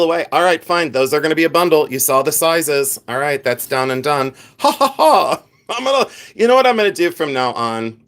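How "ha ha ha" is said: high hammed-up "ha ha ha"; fake laugh. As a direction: pop in a theatrical fake laugh. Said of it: "I'm gonna laugh sporadically".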